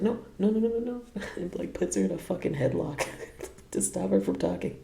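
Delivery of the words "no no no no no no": high, loud panicked no no no; frantic high no's. Retold or imitated. Fi stops her bandmate answering, frantic. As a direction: cut her off before she spills it. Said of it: "And Fi was like".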